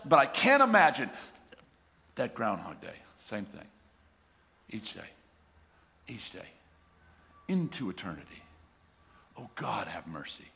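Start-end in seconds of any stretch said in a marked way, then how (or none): 2.2-10.5 hushed awe. Real, not acted. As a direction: let it sink in, almost praying. Preacher sinks into hushed awe.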